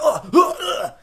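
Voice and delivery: high strained grunts; hammed-up grunts of pain; mock pained grunts. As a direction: grunt like being slammed into a wall. Butler thrown against wall.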